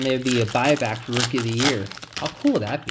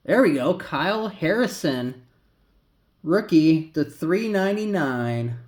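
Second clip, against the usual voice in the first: louder, higher, hyped-up breaker call; card breaker imitation; 6 semitones higher, 6 dB louder than usual. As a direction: announce the pull like a card breaker. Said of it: "That's my imitation of a, of a breaker".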